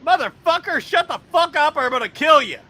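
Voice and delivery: loud, high furious threat; angry yell. Retold or imitated. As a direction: yell it like you'll kill him. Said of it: "He's like".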